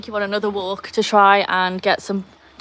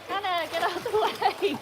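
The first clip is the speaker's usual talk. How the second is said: high-pitched plea to a dog; pleading call, pitched high; exasperated calling out; 10 semitones higher, 4 dB quieter than usual. Real, not acted. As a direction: shoo the dog from a distance. Shooing the dog again.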